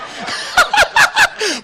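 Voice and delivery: very high squealing giggle; a squeaky giggle at his own joke. Real, not acted. Speaker laughing at his own laugh, very high giggle. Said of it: "don't laugh at my laugh".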